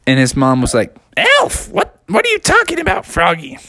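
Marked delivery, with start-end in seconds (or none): 1.2-3.6 much higher, quick, puzzled mom voice; squeaky disbelieving mother; a high bewildered cartoon mom.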